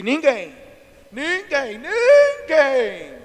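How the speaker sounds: exaggerated tearful protest; wailed complaint. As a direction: draw out the last one into a hammed-up wail. Acting out the man's grievance.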